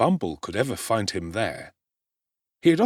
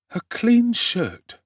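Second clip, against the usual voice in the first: put-on higher, slow young boy musing to himself; a boy's raised voice, drawn out slowly; Oliver's voice; 13 semitones higher, 5 dB louder, much slower than usual. A narrator's reading. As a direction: voice the ragged boy weighing his few things. Oliver's thoughts voiced aloud.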